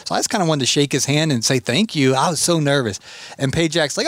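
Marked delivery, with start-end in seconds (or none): none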